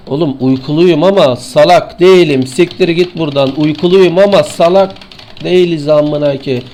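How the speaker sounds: loud, exasperated shouting at the screen; angry yell. Real, not acted. Gamer yelling at the enemy, loud and fed up. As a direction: bellow it like you lost your temper mid-fight.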